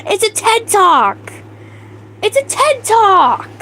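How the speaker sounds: gleeful shout-out to the chat; excited. Real, not acted. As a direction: savor the joke as it lands. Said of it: "Oh my God, that's great".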